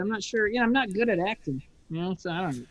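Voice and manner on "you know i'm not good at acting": ordinary talk, nothing marked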